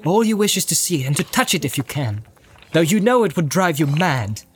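understated stern warning; stern pity. A narrator's reading. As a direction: admonish the captive with restrained pity. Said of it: "looking down at him with stern pity".

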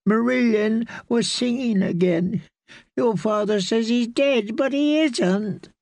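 put-on a scared boy close to crying; tearful boy. A narrator's reading. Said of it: "Tears filled his eyes".

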